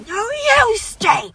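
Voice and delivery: very high-pitched mock retort; hammed-up comeback yell, drawn out; imagined yell back. Friend imagining yelling back at the heckler, high mock yell. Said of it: "I really should have yelled back".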